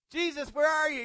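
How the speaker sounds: high, loud eager call. Acted. Blind man calling out for Jesus, loud eager call. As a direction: call out searching for someone. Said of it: "he's like".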